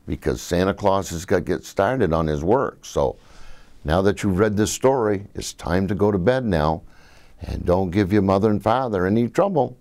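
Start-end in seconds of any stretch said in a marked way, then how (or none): none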